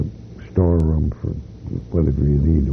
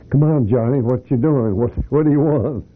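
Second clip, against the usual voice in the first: higher-pitched retold call from indoors; 7 semitones higher than usual. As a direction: imitate a man calling back to a visitor. Voice calls back from inside.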